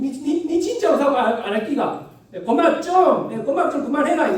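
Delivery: high, loud Gyeongsang shouting; angry Gyeongsang girl. Retold or imitated. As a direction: yell at the deskmate in Gyeongsang dialect. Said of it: "그럼".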